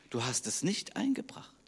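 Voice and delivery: hushed, breathless whisper of judgement; whispered rebuke. Retold or imitated. The master whispers his verdict to the lazy servant. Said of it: "sagt er".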